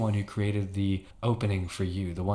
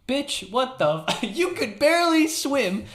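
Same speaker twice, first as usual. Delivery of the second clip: high, loud, laughing disbelief; incredulous outburst; 10 semitones higher, 6 dB louder than usual. Real, not acted. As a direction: explode in exasperated disbelief. Mock outrage at player's answer.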